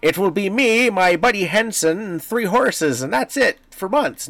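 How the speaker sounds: high, hammy movie knight voice; hammy stage actor. Retold or imitated. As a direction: ham it up like an old movie hero. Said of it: "not like it in the movies".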